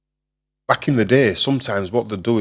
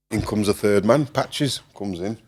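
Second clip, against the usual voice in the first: ordinary talk, nothing marked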